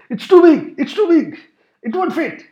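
high worried protest; a lightly voiced nervous friend; mock-panicky complaint, voiced lightly; fretful friend.

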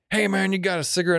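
high, imitated homeless-guy voice. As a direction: voice a homeless man asking a stranger. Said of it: "This guy's like".

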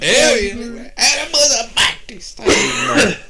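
high, loud laughing mock sermon; hammed-up preacher. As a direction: shout it like a revival preacher.

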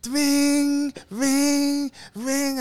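high, sustained ringing tone; imitated phone ring. Storyteller imitating the phone in his high daze, sustained high rings. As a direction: hold each ring long and high. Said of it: "My phone is not ringing".

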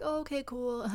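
high, put-on agreeable voice; feigned easygoing. Going along to avoid a scene, feigned cool. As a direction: play it cool with a fake little laugh. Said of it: "But really deep down, you're like".

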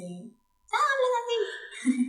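scolding mom. Retold or imitated. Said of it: "mi mamá, que nos dice".

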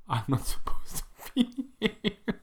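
whispered through a laugh. Retold or imitated. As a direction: giggle it under your breath. Retold startled thought in dark.

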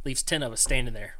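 ordinary delivery

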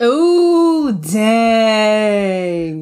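loud impressed ooh, then dang. Real, not acted. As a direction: react like that saying just hit you. Impressed by the friend's saying.

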